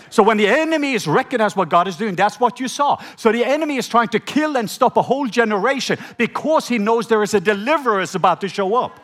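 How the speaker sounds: normal talking voice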